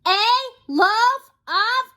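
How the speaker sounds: high, loud shout, cut off; top-of-voice shout. Acted. Mister Noisy starts shouting at the baker's wife, full shout. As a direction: yell it at full lungs. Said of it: "shouting at the very top of his voice".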